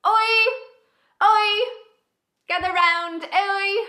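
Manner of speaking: high, loud hollering; attention-getting shouts. Acted. [name]'s mic is not working so she yells for attention. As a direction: call a chattering crowd to order. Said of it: "I try to get people's attention".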